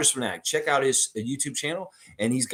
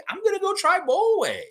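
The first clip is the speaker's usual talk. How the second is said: much higher, squeaky retake of the line; squeaky mock Scotty; 3 dB louder than usual. Host echoing Scotty's ad line in a high mock voice. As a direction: push it way up into a playful squeak. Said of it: "Then Scotty says".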